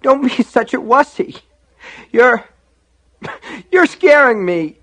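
high, loud, tearful protest; scared child breaking into crying; starting to weep. A narrator's reading. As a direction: plead as the tears begin. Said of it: "beginning to weep".

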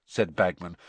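ordinary delivery

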